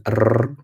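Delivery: a trilled "r"; rolled R. Shown as a demonstration. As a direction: roll the "r" on the tongue tip. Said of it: "for example, the R sound".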